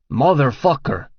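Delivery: loud pained yell; yelled curse. A narrator's reading. Petrovich shot at the door, yelling in pain. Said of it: "yells Petrovich".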